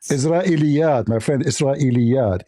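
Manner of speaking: "Israeliyat" in full, every syllable sounded; corrected pronunciation. Shown as a demonstration. Debater corrects his opponent's word, full careful pronunciation. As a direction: spell out "Israeliyat" by ear, with clear i-li-yat. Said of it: "How do I say it?".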